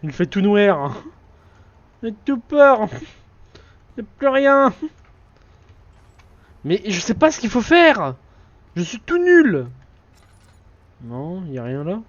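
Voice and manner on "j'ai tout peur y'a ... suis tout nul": put-on higher, louder little-kid voice; childish whine. Gamer voices a scared child in the dark, higher whine. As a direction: whimper like a scared small child.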